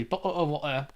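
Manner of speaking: quiet British caricature; mock British accent. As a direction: toss off British glottal stops quietly. Repeats the mock British catchphrase.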